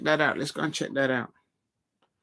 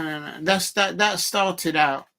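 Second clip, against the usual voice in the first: ordinary talk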